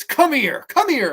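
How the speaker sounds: aggressive command. Acted. Angela dragging victim by the hair, aggressive command. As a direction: order it high and hard.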